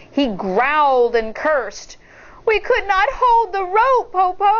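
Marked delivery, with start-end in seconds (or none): 2.4-4.5 high, lightly voiced girl feigning regret; mock apologetic, coaxing; child's voice. A narrator's reading. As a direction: Shang fakes regret to the wolf, a high child voice. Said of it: "Shang said".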